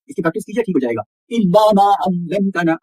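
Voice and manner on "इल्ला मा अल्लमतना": इल्ला मा अल्लमतना slowly chanted; slow tajweed reading, long aa in मा; careful recitation. Shown as a demonstration. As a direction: double the L in अल्लमतना. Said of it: "इस तरीके से पढ़ना".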